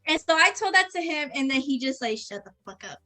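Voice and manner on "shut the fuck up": very soft, low retold reply; deflated retort. Coworker just got told off, quietly deflated. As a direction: mutter it low, beaten.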